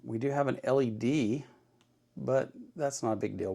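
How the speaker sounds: ordinary talk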